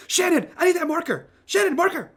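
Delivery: high, loud demand into the truck cab; frantic high-pitched shout; urgent yelling. Acted. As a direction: yell for it as if banging on the window. Mitch yells into cab, banging.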